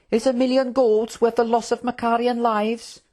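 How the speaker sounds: put-on high-pitched, indignant young royal; earnest prince. Prince challenging the Trook over war, high and indignant. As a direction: question them with high, principled concern.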